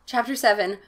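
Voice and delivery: usual speaking voice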